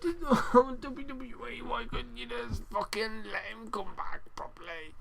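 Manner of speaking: slow, high, hushed exasperated plea; whispery high-pitched despair; frustrated whine. Real, not acted. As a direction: strain it out quietly, dragging the words in frustration. Frustrated plea, sighs after.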